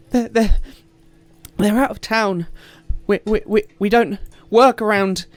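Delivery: high, loud, stammering plea; a panicked mother begging for help; frantic farmer woman. Acted. Distraught farmer woman begs the party, stammering high and loud.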